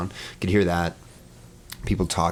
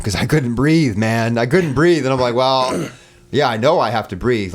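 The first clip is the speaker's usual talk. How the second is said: retold exasperation, higher and louder; worked-up, raised-voice retelling of frustration; pissed off; 6 semitones higher, 7 dB louder than usual. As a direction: protest loudly as if fed up. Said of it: "it was really pissing me off".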